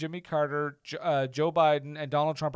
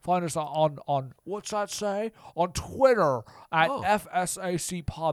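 put-on slow, stammering old movie star; Jimmy Stewart. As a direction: drag it out like a confused old actor.

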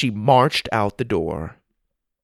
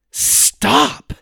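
sharp, much higher shout; 3 dB louder than usual. A narrator's reading. Sophie stopping the girls' gossip with a shout. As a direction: cut them off hard. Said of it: "Sophie shouted".

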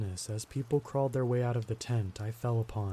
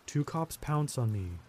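plain reading voice

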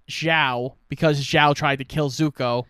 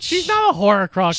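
insistent denial; 6 semitones higher, 3 dB louder than usual. Real, not acted. Arguing with co-host.

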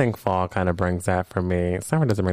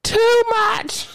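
incredulous; 3 dB louder than usual. Retold or imitated. Reacting to wild video.

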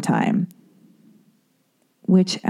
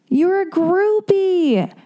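much higher, very slow, drawn-out "groupie"; sing-song mockery; 13 semitones higher, much slower than usual. Mocking the doctor's repeated verdict in a high drawn-out singsong.

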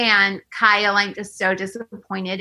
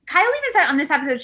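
normal talking voice

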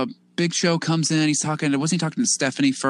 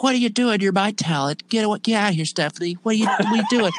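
higher, pushy boss voice; mock exasperated boss; imitating the boss; 6 semitones higher, faster than usual. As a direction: shoo him away like an annoyed boss. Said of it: "Just be like".

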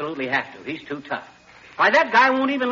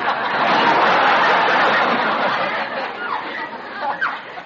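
long high wheezing laugh at his own pun; laughing hard at his own joke. Acted. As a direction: cackle on and on, delighted.

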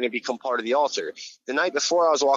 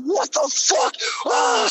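very high shrieking scream; soaked and freezing, screaming in shock; crazy scream; much slower than usual. Retold or imitated. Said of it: "screaming all crazy as fuck".

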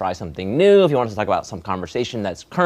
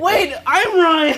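slow high mock Ryan; 5 dB louder, much slower than usual. S1 pretends to be Ryan.

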